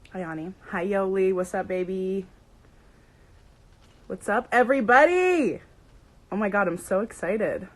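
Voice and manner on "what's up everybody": loud, higher, rousing greeting; calling out hello. Real, not acted. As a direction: shout it to the whole crowd. Calling out to arriving viewers.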